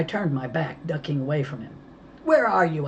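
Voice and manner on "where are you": higher and louder shout. A narrator's reading. Mo shouting at the kidnapper. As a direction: yell down the phone. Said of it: "I shouted".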